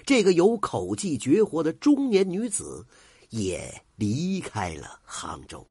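usual speaking voice

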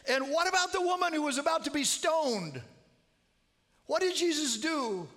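loud, high, insistent question; loud urging. Retold or imitated. Calling to deaf mother-in-law.

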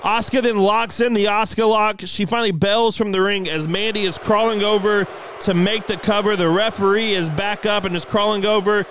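live play-by-play. Acted. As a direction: call the match like a wrestling announcer. Excited match play-by-play.

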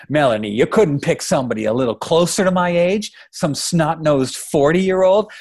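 high, scornful father's question, slowing at the end; exasperated dad needling his daughter; indignant. Acted. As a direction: drag out the jibe in disbelief. Indignant father mocks daughter's choice.

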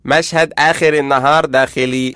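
slow, loud announcer delivery; scene-heading announcement. Acted. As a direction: announce the next scene with ringing emphasis. Calls out next scene heading.

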